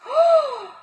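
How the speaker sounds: high surprised intake of breath; a sudden high gasp; sharp gasp. Acted. Children reacting in story.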